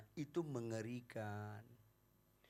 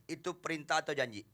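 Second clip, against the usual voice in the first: usual speaking voice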